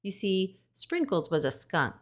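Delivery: usual speaking voice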